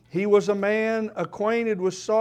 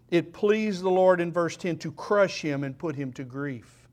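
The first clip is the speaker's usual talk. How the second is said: usual speaking voice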